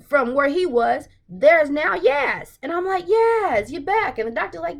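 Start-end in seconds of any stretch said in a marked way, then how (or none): 3.1-4.2 high, loud happy outburst; excited cheer. Retold or imitated. Welcoming Yaz back on screen, high and excited. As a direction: greet her return with delight. Joyful reaction to her return.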